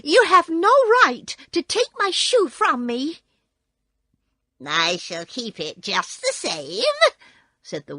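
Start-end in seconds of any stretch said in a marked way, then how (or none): 0.0-3.2 high loud indignant protest; angry girl. Acted. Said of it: "cried Dorothy". 4.6-6.8 mocking witch. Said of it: "laughing at her".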